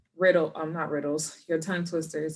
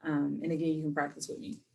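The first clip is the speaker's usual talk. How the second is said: normal talking voice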